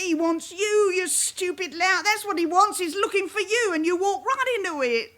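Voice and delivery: high, loud exasperated telling-off; angry scolding. Acted. Mother berates her son for walking into the police, high and loud. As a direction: scold him like a furious mother.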